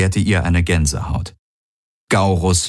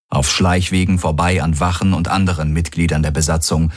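plain reading voice

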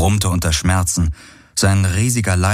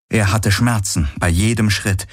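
ordinary delivery, nothing marked

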